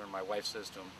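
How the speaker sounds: ordinary delivery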